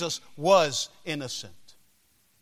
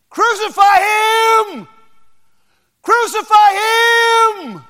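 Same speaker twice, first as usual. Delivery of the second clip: very high, very loud slow shout; crowd shout; 13 dB louder, slower than usual. Acted. Crowd answering Pilate's question, loud slow shout. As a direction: roar it like a furious mob. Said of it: "the thousands began to cry out again".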